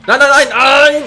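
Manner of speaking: loud, high string of frantic cries; panicked shouting. Real, not acted. Gamer about to lose the fight, loud high panic. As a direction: cry out as the hit comes.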